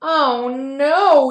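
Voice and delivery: mild mock yell of alarm. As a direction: cry out in alarm. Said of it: "yelled the mother".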